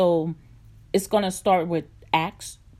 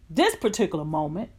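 ordinary talk

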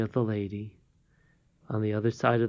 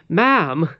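loud, higher burst of surprise; startled exclamation; 6 semitones higher, 9 dB louder than usual. A narrator's reading. Curdie reacts to the old lady's gladness, exclaiming in surprise. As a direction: exclaim in disbelief. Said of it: "exclaimed Curdie".

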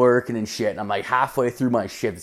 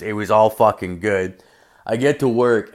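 normal talking voice

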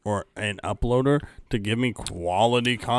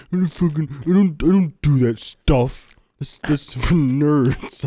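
put-on louder, slowing scoffing kid; mock dismissive kid; 3 semitones higher, 7 dB louder than usual. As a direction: scoff like a too-cool kid. Imitating his younger self.

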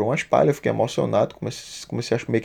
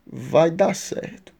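slow, higher retold reassurance; reassuring; 5 semitones higher, much slower than usual. Little boy comforting the crying seller, reassuring. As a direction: draw it out to comfort, as the boy did. Repeats the boy's comforting words, drawn out.

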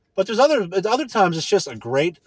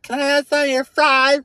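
mocking high voice; 12 semitones higher, 4 dB louder than usual. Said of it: "it's like".

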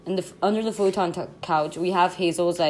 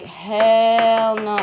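loud, long-stretched exclamation of refusal; drawn-out disbelief; 5 semitones higher, 9 dB louder than usual. Real, not acted. Friend reacting to a possum loose inside, drawn-out disbelief. Disgusted reaction to animal running indoors.

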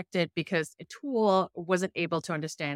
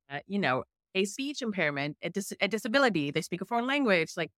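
usual speaking voice

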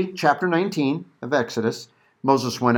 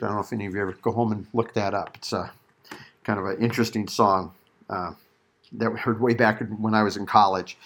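ordinary talk, nothing marked

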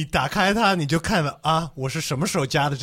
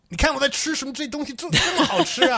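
showing off; 11 semitones higher, faster than usual. Retold or imitated. Imitating a show-off social post.

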